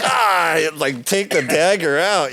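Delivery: high, loud hurt groan; mock wounded. Musician wounded by his mentor's remark, loud and high. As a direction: act stabbed by criticism.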